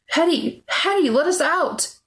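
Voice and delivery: high, desperate cries for help; high-pitched panicked call; screaming. A narrator's reading. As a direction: scream to be let out. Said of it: "Renee screamed".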